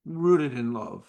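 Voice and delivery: usual speaking voice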